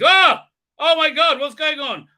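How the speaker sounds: high, loud yelp of shock; mock startled outcry. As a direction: exclaim in hammed-up alarm. Acted startled reaction in skit.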